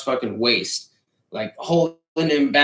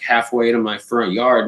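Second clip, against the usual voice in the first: ordinary delivery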